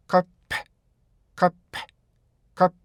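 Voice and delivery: "cup" as 카페, stop then released "p"; two-beat rhythm. Shown as a demonstration. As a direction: close on the "p" of "cup", pause, then puff it out. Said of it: "이렇게 발음하셔야 돼요".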